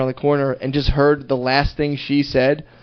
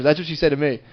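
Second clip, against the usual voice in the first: ordinary delivery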